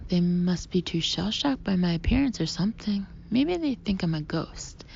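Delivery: ordinary delivery, nothing marked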